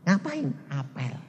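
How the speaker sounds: normal talking voice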